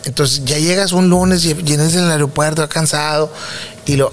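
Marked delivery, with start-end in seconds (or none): none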